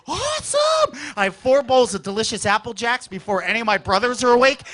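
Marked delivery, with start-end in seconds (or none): 0.1-1.0 high squeal of delight; thrilled little kid; excited kid. Retold or imitated. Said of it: "I'm like".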